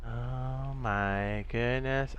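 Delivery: slow, hushed mock awe; awestruck hush. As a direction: breathe it out in wonder. Mock awe whisper, slowed.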